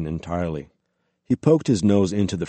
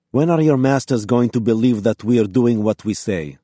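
put-on exasperated local Russian accent, raised voice; louder, higher complaint in a local Russian accent; 4 semitones higher, 6 dB louder than usual. A narrator's reading. Woman rebukes the inspectors' masters, local Russian accent. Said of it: "She had a local accent".

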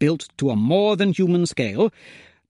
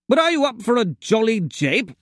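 higher, jolly upper-class chap; chirpy toff asking a favour; posh English gent; 6 semitones higher than usual. A narrator's reading. Hero's posh voice.

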